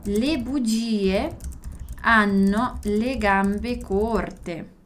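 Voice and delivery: Le bugie hanno le gambe corte said very slowly; very slow, deliberate proverb for learners; very slow dictation. Shown as a demonstration. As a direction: spell the proverb out word by word.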